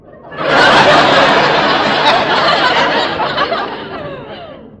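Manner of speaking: high, loud gleeful laugh. Acted. Wife laughs at her husband's surprise, high and gleeful.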